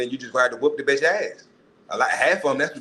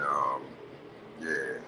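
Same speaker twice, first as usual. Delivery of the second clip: usual speaking voice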